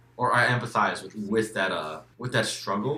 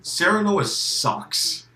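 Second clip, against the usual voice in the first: loud blunt verdict; 9 semitones higher, 5 dB louder, much slower than usual. Real, not acted.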